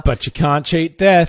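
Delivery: ominous tagline. Acted. Mock trailer tagline.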